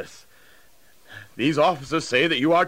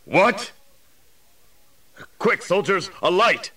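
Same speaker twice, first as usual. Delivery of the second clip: high, urgent calling; acted shocked exclamation, then command; startled; 7 semitones higher than usual. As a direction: shout orders in sudden astonishment. Startled jailer calls for light.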